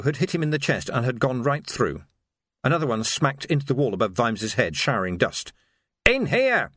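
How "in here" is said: high, restrained yell; yelled order. Acted. Sergeant ordering everyone into the shop under fire, yelled. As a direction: call the men in with a raised voice. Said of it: "he yelled".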